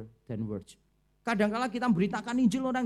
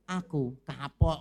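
kapok; 5 semitones lower, 4 dB louder, much slower than usual. Retold or imitated. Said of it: "Terus dijawab".

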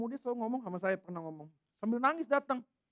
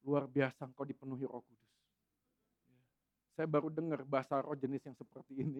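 lower mock senior pastor; 8 semitones lower, 5 dB quieter than usual. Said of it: "hamba Tuhan seniornya mengatakan".

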